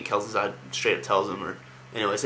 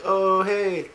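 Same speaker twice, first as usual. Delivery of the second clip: high, drawn-out surprised hello; mock surprised greeting; 10 semitones higher, 4 dB louder than usual. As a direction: greet them in exaggerated, high-pitched surprise. Acted greeting of an old acquaintance.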